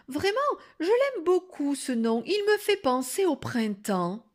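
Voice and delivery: high, gushing sweetness; extra-kind voice. A narrator's reading. Wolf gushes to the girl about the nickname, sugary. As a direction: enthuse with syrupy niceness. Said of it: "d'une voix encore plus gentille".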